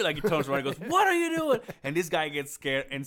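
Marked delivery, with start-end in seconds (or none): 0.9-1.6 loud, high-pitched retold holler; boss yelling. Boss turns and catches a squatting worker, mock yell. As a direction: shout it like you caught him in the act.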